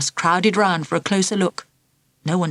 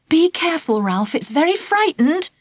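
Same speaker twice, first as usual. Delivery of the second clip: gentle teacher; 9 semitones higher than usual. Acted. Teacher warns Ralph gently.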